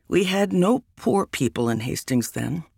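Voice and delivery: usual speaking voice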